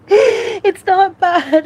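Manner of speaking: slow, loud, high sob-choked words; sobbing. Real, not acted. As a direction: burst into tears on it. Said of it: "without crying".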